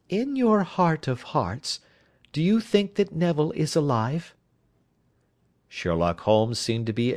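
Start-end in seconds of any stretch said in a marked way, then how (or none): 0.1-4.3 high, searching lady's question; anxious wife, pleading. Acted. Wife asks whether her husband lives, high pleading voice. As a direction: ask carefully for the truth you dread, pitched up.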